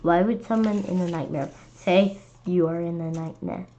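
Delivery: plain reading voice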